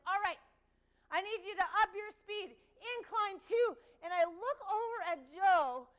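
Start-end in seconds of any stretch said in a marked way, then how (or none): 0.1-3.7 loud coaching call; trainer shouting. Retold or imitated. Trainer pushing contestant to speed up, shouting. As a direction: push them harder, loud. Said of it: "she's like".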